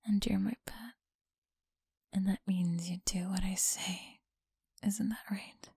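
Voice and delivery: teasing close whisper; breathy whisper to a listener. Acted. Whispered domination.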